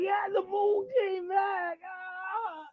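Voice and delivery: put-on slow, high, loud drunken cheer; wasted rocker. Wasted guy cheering the moon's return, high slurred shout. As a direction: shriek with drunken joy. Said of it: "blitzed out of his mind".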